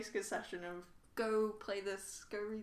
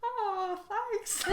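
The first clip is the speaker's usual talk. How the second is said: high, louder mock-touched coo; flattered gushing; 12 semitones higher, 5 dB louder than usual. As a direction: gush with thanks, breaking into a laugh. Player touched at being called best friend.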